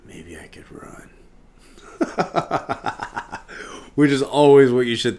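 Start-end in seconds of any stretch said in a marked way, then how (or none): 0.1-1.1 soft, unvoiced secret thought; whispering. Whispered thought before the fight. 1.8-3.9 airy, high unvoiced chuckle; a breathy laugh at himself. Real, not acted. Laugh after his whisper.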